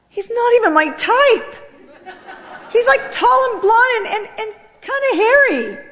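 high-pitched comic objection; hammed-up dismay; incredulous protest. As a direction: whine it up high, as if arguing with God. Acted protest to God.

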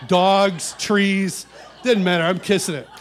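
loud, high laughing list. Real, not acted. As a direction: laugh through the absurd list. Laughing at own excess.

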